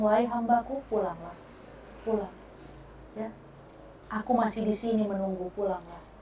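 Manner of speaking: soft, tender call to come home; gently beckoning a lost servant. Acted. God calling His servant home, soft and tender.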